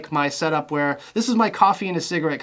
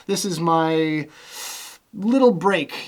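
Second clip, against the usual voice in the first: ordinary delivery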